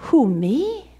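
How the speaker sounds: high, startled "who, me?"; incredulous Moses. Acted. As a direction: Moses to God, balking at being chosen, disbelieving. Moses protests God's call.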